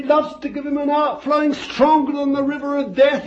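high, loud, ringing preacher's cry; full-force preaching. Real, not acted. Preacher exulting over the dove on Jesus, shouting. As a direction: lift the whole room with it.

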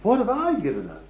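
hammed-up protest about sacrifices; mock indignation. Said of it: "he didn't talk like that".

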